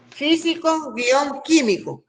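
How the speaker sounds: slow, measured dictation; dictating. Shown as a demonstration. Teacher repeating the practice word for students, dictating slowly. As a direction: repeat it slowly, starting high on Físico. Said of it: "pongo a ustedes la palabra".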